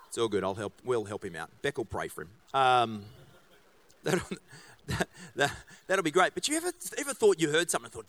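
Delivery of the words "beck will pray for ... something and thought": ordinary talk, nothing marked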